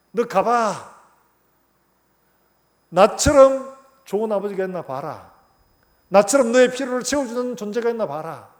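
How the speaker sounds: a stern father's loud dare; God's challenge. Acted. God voiced challenging son.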